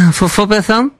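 put-on confused French-accented English; mock broken English. Prank caller confused about rooms, French-accented English. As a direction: fumble the words like a lost tourist.

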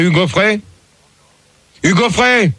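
normal talking voice

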